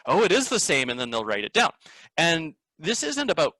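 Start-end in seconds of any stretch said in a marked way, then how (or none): 0.1-0.9 imitating student realizing. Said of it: "kid in the class will be like".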